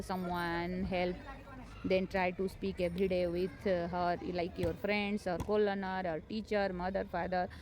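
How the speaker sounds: usual speaking voice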